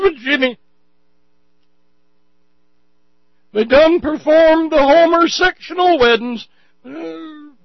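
high, loud hammed-up yokel voice, shrieking in alarm. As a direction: play an over-the-top panicked Southern zealot. Mocking Moore's supporters in caricature.

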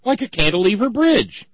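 high loud joking exclamation; excited. Real, not acted. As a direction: blurt it out laughing. Joking about bra wire.

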